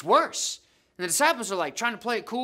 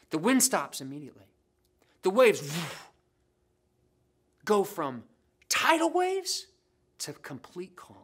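ordinary talk

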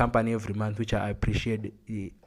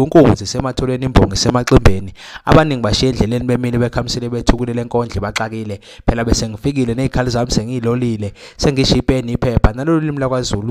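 loud rhythmic praise-poem chant; ecstatic praise shouting; 10 dB louder than usual. Acted. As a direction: declaim like an imbongi praise poet. Praise poem opening the show.

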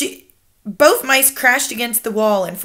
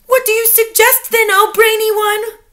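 high, louder witchy plea; eager crying out; 12 semitones higher, 5 dB louder than usual. A narrator's reading. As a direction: fawn on your leader eagerly. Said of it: "they cried out".

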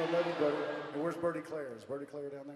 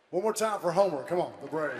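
crowd hype; 8 dB louder than usual. Real, not acted. Hyping crowd for mascot.

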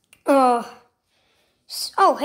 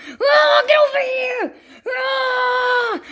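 high, roaring chase yell; raging Donkey Kong; 7 semitones higher, much slower than usual. Acted. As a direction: roar as you charge after someone. Enraged Donkey Kong starts chasing.

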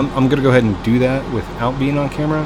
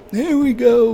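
put-on high, strained old man voice; 4 dB louder, slower than usual. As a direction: croak it out like a grumpy grandpa. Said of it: "That's my old man voice".

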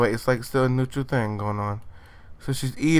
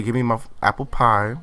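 ordinary talk, nothing marked

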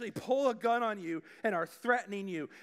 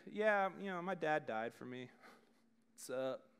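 casual; 4 semitones lower, 4 dB quieter than usual. Shown as a demonstration. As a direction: lower and softer, shrugging it off. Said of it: "say it casually like that".

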